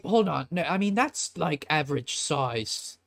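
affronted shopkeeper protesting; defensive protest. Acted. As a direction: butt in defensively, then stretch out the last words. Shopkeeper protests the tiny pistol jibe.

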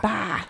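"Ba" for bar with an open ah. Shown as a demonstration. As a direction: echo "Ba" softly, no r at all. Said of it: "Everything's ah".

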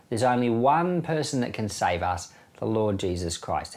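ordinary talk, nothing marked

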